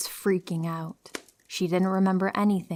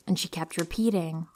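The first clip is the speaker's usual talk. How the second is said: ordinary delivery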